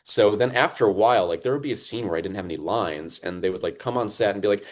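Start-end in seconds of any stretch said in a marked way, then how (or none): none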